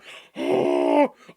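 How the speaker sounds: sharp, high breathy gasp; loud gasp. Real, not acted. Shocked by the kiss.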